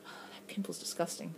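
very soft, voiceless aside; whispered aside. Real, not acted. Vlogger noticing their own pimple on camera, whispered aside. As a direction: drop to a whisper, as if to yourself.

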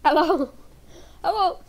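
ordinary delivery

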